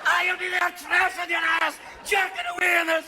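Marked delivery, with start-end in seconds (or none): none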